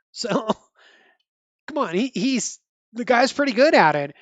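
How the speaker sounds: ordinary delivery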